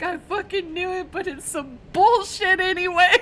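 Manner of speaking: high, wailing imitation of tearful outrage; mock crying. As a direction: act out a sobbing protest, swelling loud at the end. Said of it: "he just starts crying, and he's like".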